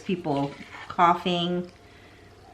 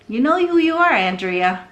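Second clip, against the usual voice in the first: accusing call-out; 6 semitones higher, 4 dB louder than usual. Real, not acted. Calling out Andrea.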